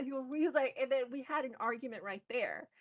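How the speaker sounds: usual speaking voice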